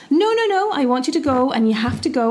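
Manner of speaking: high, rapid overruling; insistent wife. Acted. Wife ordering her reluctant husband back to the fish, acted insistence. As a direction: cut in fast as a demanding wife.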